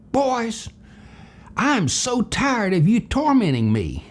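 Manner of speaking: mock exasperated scolding, pitched high; high-pitched, fed-up retold reprimand; angry Mister McCoy. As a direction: imitate an angry man telling off two boys. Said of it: "angry Mister McCoy".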